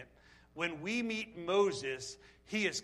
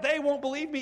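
anxious protest; 7 semitones higher, 6 dB louder than usual. Retold or imitated. Moses objecting to God, high and loud. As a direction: plead that nobody will listen. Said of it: "He said to them flat out".

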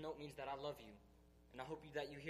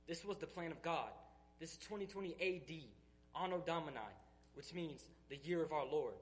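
building, louder fervent preaching; full-force preaching; 4 dB louder than usual. Acted. Speaker to his church, declaring he is done with fear, in building preaching. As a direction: pour it out like a revival sermon, climbing.

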